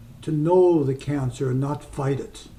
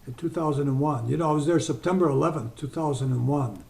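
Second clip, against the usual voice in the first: ordinary talk, nothing marked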